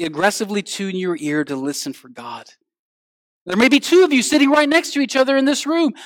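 ordinary talk, nothing marked

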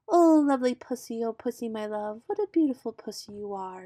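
lightly crooned serenade, starting high and loud, then easing; serenading owl. A narrator's reading. Owl serenades the pussycat in a recited poem, lovestruck crooning. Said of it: "sang to a small guitar".

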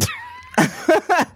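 high shrieking burst of laughter. Real, not acted. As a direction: let out a squealing laugh. High laugh at repeat question.

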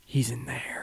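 hushed, raspy whisper; soft raspy whisper. Retold or imitated. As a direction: breathe it in a low raspy whisper. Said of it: "in this kind of soft, raspy voice, she goes".

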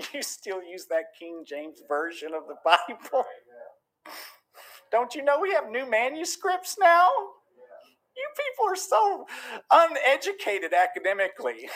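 put-on condescending scholar, climbing high; mock a snooty academic scoffer; scoffing evangelical. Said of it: "even the evangelicals are scoffing at us".

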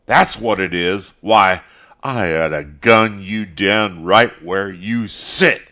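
loud, furious threats; sputtering rage. A narrator's reading. Caleb Wallace threatening Mister Morrison, sputtering fury. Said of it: "then sputtered".

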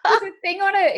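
high voice through laughter; laughing. Real, not acted. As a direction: say it while cracking up. Laughing while telling.

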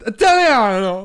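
loud, slow, drawn-out call; hammed-up street vendor call; Italian shout. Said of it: "some guy went".

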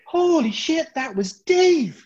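high, drawn-out stunned exclamation; acted shock. Colleague recognising Dave on the tannoy, acted shock. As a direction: stretch the words out in loud mock disbelief.

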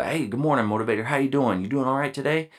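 upbeat morning call to the team; cheerful greeting. Retold or imitated. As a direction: greet them like a pumped-up leader. Leader greeting a marine cheerfully.

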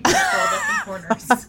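high, loud mock wail; mock anguish. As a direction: wail it like a tragic victim. Mock tragic scene.

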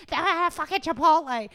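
high-pitched, loud mock scream; hammed-up furious shriek; imitated screaming. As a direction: scream it as an impression of someone ranting online. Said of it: "he's, like, screaming about it".

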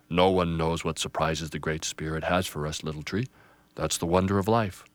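put-on an old pine gently correcting; booming grandfather tree. Grandfather wise.

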